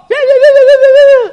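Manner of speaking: very high loud "ya-ya-ya"; hammed-up squealing chatter; shrieked nonsense babble between buddies; excited babble.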